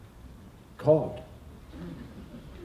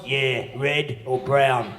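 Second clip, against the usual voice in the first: mock shopkeeper; much slower than usual. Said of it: "You just go".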